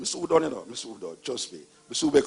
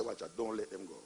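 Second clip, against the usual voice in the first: soft, lower secret warning; conspiratorial whisper; 7 semitones lower, 16 dB quieter than usual. Acted. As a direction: hush it like a secret. God whispering to Pharaoh.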